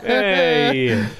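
usual speaking voice

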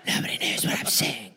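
voiceless conspiratorial whisper. Acted. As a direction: lay out the plan in a whisper. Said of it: "I'm the Whisperer".

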